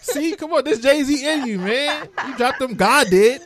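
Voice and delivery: high, loud hyping; excited hype. Real, not acted. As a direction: cheer her on excitedly. Hyping the guest.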